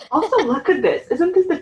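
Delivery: laughing while showing the battered book; amused laughing call to look. Real, not acted. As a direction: giggle through it, voice up high. Shows the falling-apart book, laughing.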